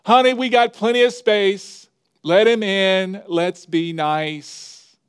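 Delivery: wife yelling. Acted. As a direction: shout it out kindly to her husband, drawing out "let's be nice". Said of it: "Mister Frog's wife yelled out".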